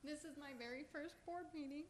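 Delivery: fighting back tears while speaking; getting emotional. Real, not acted. First-time speaker at board meeting, choking up. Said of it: "I don't know why I'm getting emotional".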